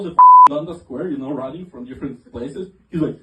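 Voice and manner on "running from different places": usual speaking voice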